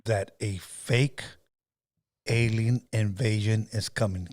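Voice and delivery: slow, deliberate, measured delivery with pauses; careful. Shown as a demonstration. Host models the line for her to repeat, slow and careful. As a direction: say it carefully, word by word, slowly. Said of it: "want you to say it carefully".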